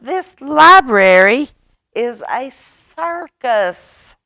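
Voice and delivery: high, loud and slow complaint; exasperated. Acted. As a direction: shout it over the circus noise. Stevie complains over the din.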